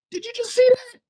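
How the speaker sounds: very high-pitched astonished question; stunned disbelief. Retold or imitated. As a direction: squeak it out, imitating your amazed self. Stunned, asking the girl.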